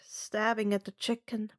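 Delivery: ordinary delivery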